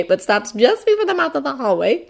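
ordinary delivery

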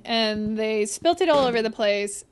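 ordinary delivery

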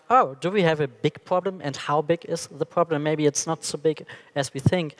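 ordinary talk

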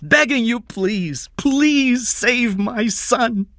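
high, loud, drawn-out begging; desperate pleading. Acted. Father begging a rich man to save his son, desperate plea.

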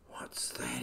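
soft breathless whisper; scared whisper. Acted. Kiwi chick clinging to mum's leg, scared whisper. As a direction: hide behind mum and whisper.

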